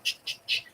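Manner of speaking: soft voiceless ch-ch-ch; quiet whispered scribble sound effect; scribbling noise. Retold or imitated. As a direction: imitate a quick pen signature. Imitates quickly signing an autograph.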